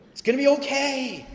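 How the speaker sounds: hammed-up breezy promise; mock carefree reassurance. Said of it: "She didn't say".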